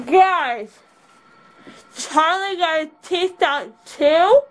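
ordinary delivery

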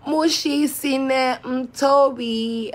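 "Mushi Sine Mtobi" said slowly and loudly, syllable by syllable; careful name pronunciation. Shown as a demonstration. Carefully pronouncing a viewer's name, slow and loud. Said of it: "Let me pronounce your name".

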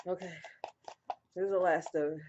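normal talking voice

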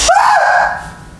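sudden very high held scream. Pretend. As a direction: let out one long, high scream. Scream while working out.

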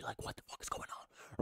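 hushed whisper, almost to himself; whispered aside. Real, not acted. Fan ranting on camera whispers his disbelief. Aside to viewers mid-rant.

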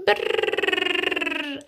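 high little shiver; teeny tiny voice. Acted. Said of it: "in a teeny tiny voice".

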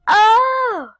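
high loud delighted oh; surprised gasp. Real, not acted. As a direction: react to a surprise gift. Opening a gift.

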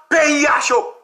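high mock news alarm; mimicked outcry. Commentator quoting the media, high outcry.

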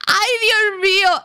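slow, high laughing cry; laughing exclamation. Real, not acted. Player watching the fight, laughing cry. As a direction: gasp it out through the laugh. Reacting to the brawl.

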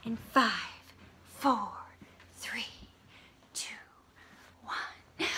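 hushed countdown; countdown whisper. Acted. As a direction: whisper the numbers down. Countdown before blast off.

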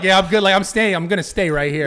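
loud, laughing mock defiance; laughing retelling. Telling friends about staying after the fight, loud laughing. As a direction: crack up while playing it cool. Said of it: "And I was like".

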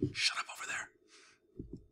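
slow hissed whisper. Acted. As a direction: hush them before he hears. Hushing other villagers.

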